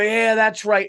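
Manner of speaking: mocking the parents' loud, high whoop of approval; rowdy parents cheering. Parents cheering their kid's screaming, loud mock whoop. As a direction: egg the screaming kid on, hammed-up. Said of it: "they would go".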